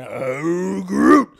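exaggerated movie-creature impression; Groot. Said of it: "to prepare for Groot".

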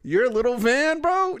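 high, loud mocking jab. Real, not acted. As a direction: throw it back mockingly. Mocking friend's word.

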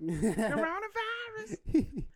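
squeaky high laughing cry; very high, slow and laughing. Real, not acted. Joking after the cough.

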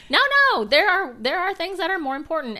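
loud, high, insistent; emphatic. Retold or imitated. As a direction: insist it firmly. Jesus voiced emphatically.

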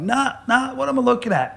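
high-pitched imitation of a baffled wife; disbelieving wife. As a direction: mimic her disbelief, pitched well up. Wife's disbelief at scan, retold.

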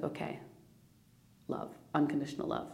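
softly retold self-soothing; soft, low-key loving murmur to yourself. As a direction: ease into a quiet, tender acceptance. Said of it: "came in a little bit more into the love".

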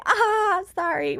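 high-pitched mock sheepish "oops"; sheepish apology. Traveler replaying her apology to the lady, sheepish. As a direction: squeak out a retold apology.

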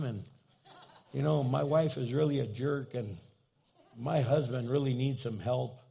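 lightly mocked nagging prayer; mock whiny prayer. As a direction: pester God like an impatient believer. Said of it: "Have you ever prayed like that?".

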